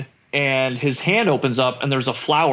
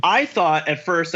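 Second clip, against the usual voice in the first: ordinary talk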